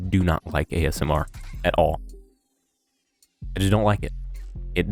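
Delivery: normal talking voice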